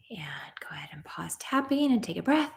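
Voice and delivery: soft whisper, breathy and gentle; whispered instruction. Real, not acted. Coach telling the group to pause tapping, whispered. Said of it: "soft whispered instruction".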